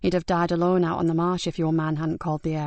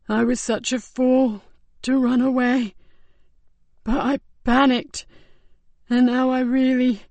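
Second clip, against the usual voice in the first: higher, slow, tearful strained plea; weak pleading dying man; 4 semitones higher, 4 dB louder, slower than usual. Acted. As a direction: beg weakly between ragged breaths.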